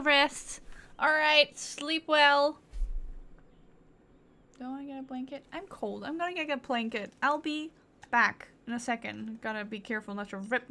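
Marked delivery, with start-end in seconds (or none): none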